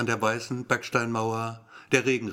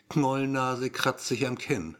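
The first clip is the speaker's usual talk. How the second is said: ordinary delivery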